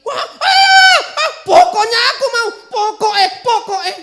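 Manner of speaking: put-on high, loud child tantrum; tantrum shout. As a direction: scream it like a spoiled kid who won't take no. Child yells at mother.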